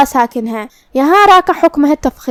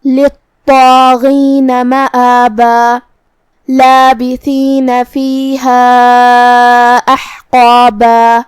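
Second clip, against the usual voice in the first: slow, drawn-out tajweed chant ending on a long alif; slow Quran recitation; 4 dB louder, much slower than usual. Shown as a demonstration. Teacher recites the verses for students, slow chant. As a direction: chant slowly, holding each long vowel. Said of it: "اس پہ مد الف سے رکیں گے".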